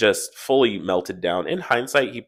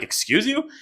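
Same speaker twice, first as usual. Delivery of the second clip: much higher, offended "Excuse you?"; shocked retort; 14 semitones higher than usual. Retold or imitated. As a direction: snap it back in disbelief, pitched way up. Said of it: "I was like".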